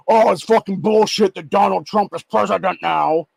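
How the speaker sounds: loud, slow, high-pitched griping; exaggerated bellyaching, voice pushed up and out; mock moaning. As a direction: ridicule them with a drawn-out whine of complaint. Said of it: "to fucking bitch and moan".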